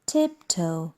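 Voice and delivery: usual speaking voice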